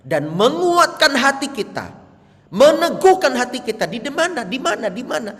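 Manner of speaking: high, loud preaching; fiery preaching. Real, not acted. Pastor challenging the congregation, loud and high. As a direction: hammer the question home.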